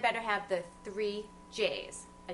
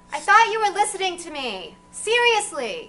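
higher and louder, indignant snap; angry outburst; 7 semitones higher, 12 dB louder than usual. Acted. Annoyed woman shouting at her rude date. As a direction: blow up at him, pitch climbing.

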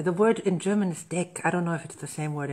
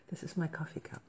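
whispered aside, much softer; 14 dB quieter, faster than usual. Real, not acted. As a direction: breathe it like a private aside to the camera.

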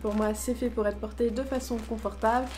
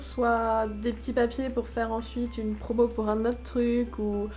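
normal talking voice

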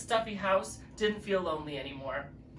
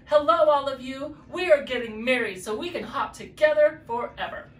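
louder, higher happy news; joyful announcement; 5 semitones higher, 6 dB louder than usual. A narrator's reading. Bunnies announcing their wedding to friends, joyful. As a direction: announce it proudly to everyone.

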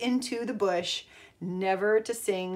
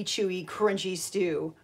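ordinary delivery, nothing marked

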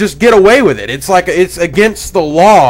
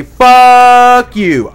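loud defiant yell, a bit higher; pretend shout; 7 semitones higher, 7 dB louder than usual. Yelling at the Xbox camera in imagined defiance, loud shout. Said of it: "and I'm gonna say".

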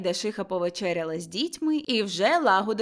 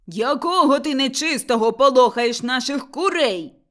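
loud, indignant yell; angry shout from the ladder; 4 semitones higher, 7 dB louder than usual. A narrator's reading. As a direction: snap at her loudly. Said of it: "крикнув Лаврін".

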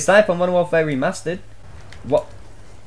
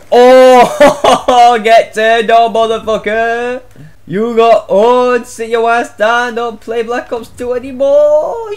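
loud gloating taunt; 6 semitones higher, 6 dB louder, slower than usual. Real, not acted. Gamer taunting killed enemy.

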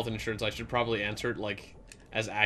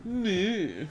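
high, loud mocking imitation; hammed-up taunt ending in mocking noises; condescending; 9 semitones higher, 10 dB louder, slower than usual. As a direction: parrot it in a silly patronizing voice. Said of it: "he sounds so condescending".

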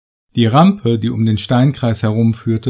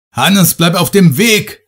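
higher, louder, slow yell across the cave; bellowing; 7 semitones higher, 5 dB louder than usual. Acted. Foreman yells to his friend to stay on the path, bellowing. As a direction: shout a warning to a friend out of sight. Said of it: "brüllte der Baustellenleiter seinem Freund zu".

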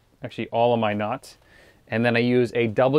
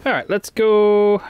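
high, drawn-out let's go; rally yourself to get moving, stretching it out high; sing-song send-off, loud and high; upbeat rallying call; 9 semitones higher, 3 dB louder, slower than usual. Real, not acted. Setting off to move spots.

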